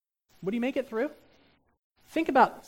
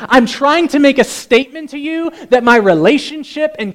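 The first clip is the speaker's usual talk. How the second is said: loud, raised, emphatic preaching voice; impassioned pulpit voice, pitched higher; full-force preaching; 6 semitones higher, 20 dB louder than usual. Acted. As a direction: declare it forcefully to the congregation.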